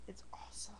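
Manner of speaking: whispered under the breath. Real, not acted. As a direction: whisper it like a secret. Whispered aside about secret website.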